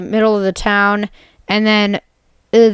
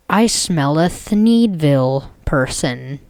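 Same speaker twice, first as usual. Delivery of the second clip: low, slow monster growl; a mild mock monster voice; mutant sniffing; 5 semitones lower, slower than usual. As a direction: lower and slow, a mutant on the hunt. Mutated Chad sniffing out enemies.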